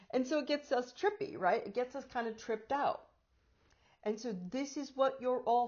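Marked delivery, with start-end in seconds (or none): none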